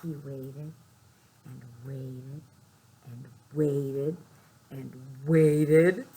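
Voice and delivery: suspenseful repetition building up. A narrator's reading. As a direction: let each waited grow.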